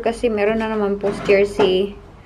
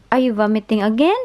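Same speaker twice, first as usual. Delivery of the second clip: usual speaking voice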